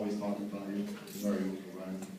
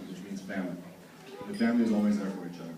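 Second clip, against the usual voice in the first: usual speaking voice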